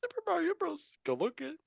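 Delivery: very high cartoon voice; squeaky video game hero; Mario. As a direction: pitch it way up for the film plug. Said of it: "'cause I'm Mario".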